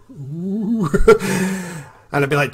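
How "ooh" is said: put-on scared kid. Scared kids react.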